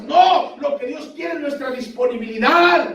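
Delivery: high, urgent pulpit exhortation; fervent preaching. Real, not acted. Preacher urges the brothers to act on the heart's prompting, fervent preaching. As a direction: preach it high and driving, stretching the last words.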